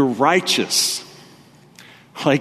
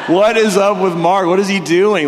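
laughing neighbour; 7 semitones higher, 5 dB louder than usual. Retold or imitated.

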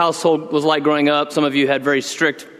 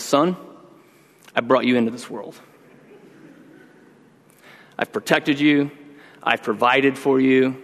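mock stern father speech; 5 semitones higher than usual. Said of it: "I usually start with something like this".

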